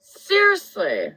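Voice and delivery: ordinary talk, nothing marked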